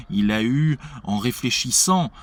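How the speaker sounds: ordinary talk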